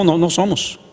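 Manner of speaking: ordinary talk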